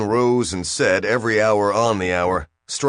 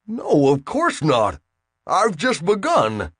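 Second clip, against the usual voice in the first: slow, high protest; incredulous; 8 semitones higher, slower than usual. A narrator's reading. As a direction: protest in disbelief. Bill protests.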